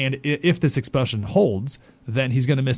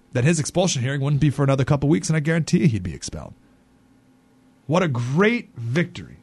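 usual speaking voice

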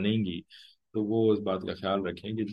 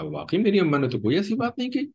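high-pitched acted reproach; raised, accusing voice of a defensive son; son defending his mother; 8 semitones higher, 4 dB louder than usual. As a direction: play a son siding with mum against his wife. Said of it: "वो कहेगा".

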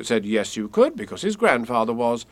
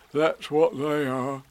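a touch of a slow teddy bear voice; gentle bear asking a friend; mild Pooh voice; lightly voiced Pooh bear; 4 semitones higher, slower than usual.

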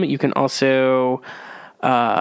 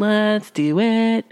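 high, slow, drawn-out cheer; excited; 7 semitones higher, much slower than usual. Real, not acted. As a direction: stretch it out with enthusiasm. Cheering to start the new year.

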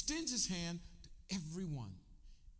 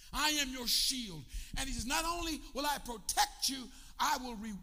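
full-force preaching; 6 semitones higher, 5 dB louder than usual. Acted. Preacher to congregation, booming God's promise in full force. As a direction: thunder out the promise of protection.

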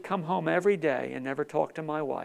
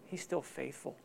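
soft emphasis; 4 semitones lower, 13 dB quieter than usual. Real, not acted. Repeats the line softer.

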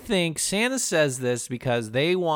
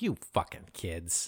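much lower, quieter grumbling curse; gruff mock-angry Santa; 10 semitones lower, 10 dB quieter than usual. Santa cursing the kids after the crash, low mock growl. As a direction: growl it like a grumpy old man.

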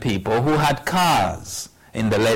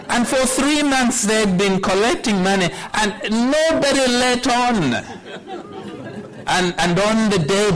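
excited; 7 semitones higher than usual. Real, not acted. Telling about the church's surprise collection.

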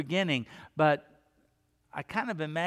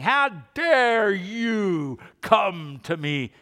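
slow, loud, much higher indignant roar; angry God; 5 semitones higher, 7 dB louder, much slower than usual. Pretend. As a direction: thunder it out slowly, offended. Imagined wrathful God scolding sinner.

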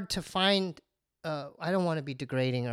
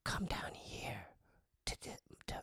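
whisper; 2 semitones higher, 14 dB quieter than usual. Retold or imitated. Whispering to her to come down, hushed. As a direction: coax in a furtive whisper. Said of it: "I whispered".

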